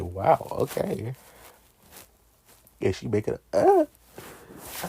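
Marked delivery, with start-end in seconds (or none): 0.0-1.1 slow, drawn-out wow okay; stunned. Retold or imitated. Stunned by the movie scene, slow wow. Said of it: "I said". 3.5-3.9 high, loud mock moan. Imitating her moan in the scene, a high squeal.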